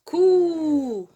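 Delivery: "cou" with the oo held long and loud; drawn-out cou. Shown as a demonstration. Teacher repeating C avec ou for pupils, long loud cou. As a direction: stretch "cou" out firmly. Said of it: "elle se prononce".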